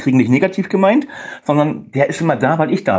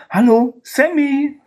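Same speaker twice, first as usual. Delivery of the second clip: hyped host greeting; 11 semitones higher, slower than usual. Real, not acted. Host hypes up guest.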